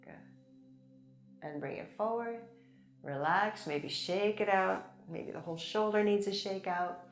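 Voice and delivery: ordinary talk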